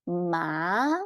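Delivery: "Ma" gliding up like a question; second tone. Shown as a demonstration. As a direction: raise the pitch through "Ma". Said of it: "Second tone".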